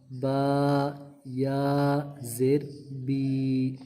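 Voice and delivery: الف زبر با said slowly, vowel held long; drawn-out syllables. Shown as a demonstration. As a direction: stretch each vowel over two beats. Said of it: "اب میں جتنا اس کو لمبا کر رہا ہوں نا".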